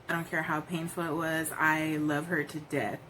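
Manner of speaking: usual speaking voice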